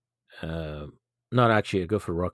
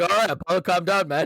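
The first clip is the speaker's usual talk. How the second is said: high, playful scolding; high mocking voice; 9 semitones higher, 3 dB louder than usual. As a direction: tease him in a squeaky voice. Mocking reply to Apollo.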